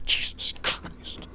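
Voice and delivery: sighed out slowly on breath, barely voiced; drained, breathy groan of disbelief; weary sigh. Real, not acted. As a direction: let it all out in a tired exhale. Exasperated aside after a grim realization.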